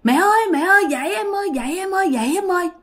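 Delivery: high pleading call to wake up; family calling. Retold or imitated. Husband and kids calling Thi to wake, high pleading call. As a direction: call her to wake from afar. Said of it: "còn nghe tiếng".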